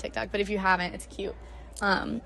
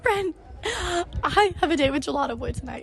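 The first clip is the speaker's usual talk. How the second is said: excited squeal; 8 semitones higher than usual. Retold or imitated. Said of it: "screaming at Ren".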